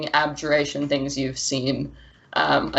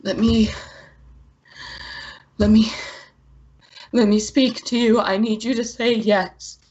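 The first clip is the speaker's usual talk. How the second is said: strained high plea, breath barely coming; choking, breathless; 6 semitones higher than usual. Acted. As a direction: choke out each plea, halting. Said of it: "like breath is barely able to come".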